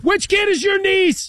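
very high, hollered sideline question; shouting parent. Acted. Parent hollering at the coach over the field, mock shout. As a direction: yell it across the field, hammed up.

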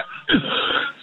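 choking on cereal, coughing hard; real coughing fit. Real, not acted. Caller choking on cereal mid-call, real coughing. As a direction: cough as if something's stuck. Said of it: "I thought you were acting".

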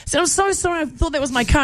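high panicked apology; flustered apology. Retold or imitated. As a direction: babble an embarrassed apology. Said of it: "And I just go".

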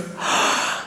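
quiet intake of breath; soft whispered gasp. Retold or imitated. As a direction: gasp softly in wonder. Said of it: "Liv Tyler looks at me and just goes".

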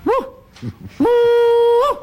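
high hooting woo, loud and rising; bunyip mating call. Acted. Old bushman shows the mating call of the wild bunyip, hooting loud and high. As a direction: hoot it out like a wild creature calling its mate. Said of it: "So I did it again".

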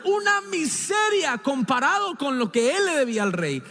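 normal talking voice

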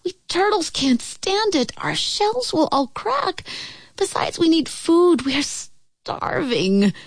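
high, pitiful pleading voice; small, suffering underling begging for relief; plaintive squeaky complaint; groaning little turtle. A narrator's reading. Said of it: "groaned Mac".

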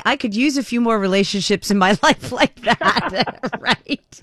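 joking through real laughter; laughing. Real, not acted. Host joking with caller about needing such relationships, laughing.

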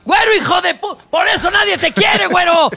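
high, shouted heckling; mock abusive yelling. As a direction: play a fan hurling insults. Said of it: "que gritar cosas horribles".

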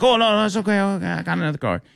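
high flustered mock Indian accent, rushing; imitated Indian accent. Passenger whose card declined, flustered Indian accent. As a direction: hurry to offer a new card in an Indian accent. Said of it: "Indian accent".

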